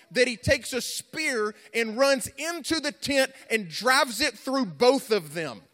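climactic preaching. Acted. Preacher tells how Phinehas ran into the tent, climactic preaching. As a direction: stretch out each phrase with passion.

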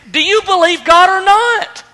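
high, forceful demand; emphatic angel's ultimatum; confrontational challenge. Acted. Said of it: "Gabriel almost confronts Zechariah".

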